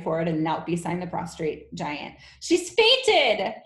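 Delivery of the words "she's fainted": high, loud cry of alarm. Acted. Miss Honey crying out beside the fallen headmistress. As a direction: cry out in shock. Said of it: "she cried".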